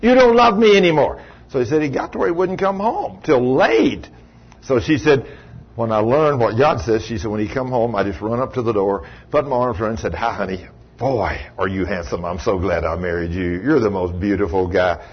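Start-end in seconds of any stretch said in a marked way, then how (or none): 0.0-1.2 raised whiny nag; mock grumbling complaint. Wife complaining to her husband, a whiny nag. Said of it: "I'd grumble and complain". 10.1-15.0 sweet greeting. Retold or imitated. Wife greets husband sweetly.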